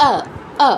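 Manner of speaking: আ as a short, unrounded schwa; Sanskrit short vowel. Shown as a demonstration. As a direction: drill short আ with lips spread, not rounded. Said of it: "ভালোভাবে উচ-, আয়ত্ত করতে হবে". (ঠোঁট ছড়িয়ে ছোট সংস্কৃত আ)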